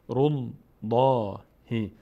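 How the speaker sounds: drawn-out recited syllables; slow syllable demo. Shown as a demonstration. Teacher joining ra to lam for learners, slow and drawn out. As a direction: hold each syllable long.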